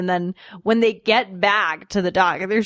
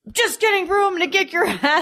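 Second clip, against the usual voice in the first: mock threat; 9 semitones higher, faster than usual. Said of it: "And then he's like".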